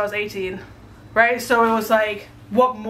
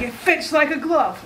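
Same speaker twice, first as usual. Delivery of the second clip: playful raised voice; half-hearted shout, pitched up; mock yell; 7 semitones higher than usual. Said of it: "You want me to yell it?".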